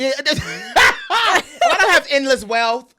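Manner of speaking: loud, high laughing protest. Real, not acted. Joking about affording five kids, laughing loudly. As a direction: shout it through real laughter.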